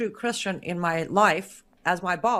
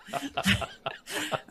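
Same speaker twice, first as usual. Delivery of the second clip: short unvoiced rising laugh; a quick airy laugh; breathy laugh; 6 semitones higher, 5 dB quieter than usual. Real, not acted. Laughs at her old self.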